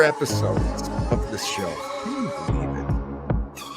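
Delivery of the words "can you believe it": ordinary talk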